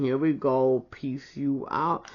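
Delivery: ordinary talk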